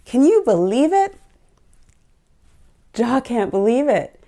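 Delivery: ordinary talk, nothing marked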